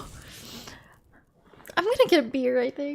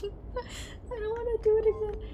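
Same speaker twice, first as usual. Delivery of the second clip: laughing whine; 7 semitones higher, 6 dB quieter than usual. Real, not acted. Laughing, half-crying plea.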